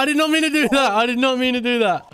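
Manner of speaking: shouted and high, then dying down; loud apology fading. Acted. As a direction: yell it, then let it fade.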